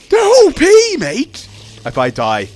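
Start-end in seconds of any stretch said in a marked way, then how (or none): 0.1-1.5 shrieked complaint. Real, not acted. Enemy too strong.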